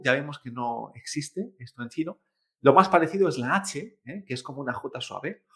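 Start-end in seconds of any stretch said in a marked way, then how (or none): none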